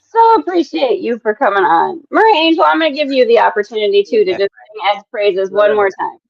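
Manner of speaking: ordinary delivery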